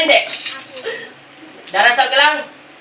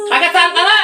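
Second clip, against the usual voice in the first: high, raised-voice repeated question; insistent demand; 8 semitones higher, 4 dB louder than usual. Real, not acted. Healer presses patient on where they are from, high and insistent. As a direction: demand the answer, pitching it sharply up.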